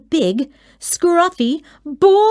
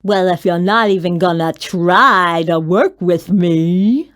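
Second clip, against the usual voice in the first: whined; 4 semitones lower, 3 dB louder than usual. A narrator's reading. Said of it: "Mac whined".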